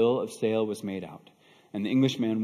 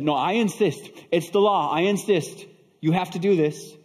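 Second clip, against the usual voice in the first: insistent; 7 semitones higher, 6 dB louder than usual. Acted. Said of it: "began to protest".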